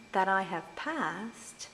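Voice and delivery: that I have passed, said very slowly; slow, weighty short line; slow verse fragment. Shown as a demonstration. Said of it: "fourth line".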